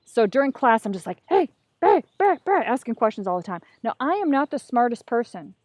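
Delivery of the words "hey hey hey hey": high, loud bursts for attention; insistent calling out. Retold or imitated. Student badgering the teacher during class, insistent calling out. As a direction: butt in like an overeager student.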